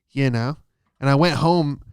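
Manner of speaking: normal talking voice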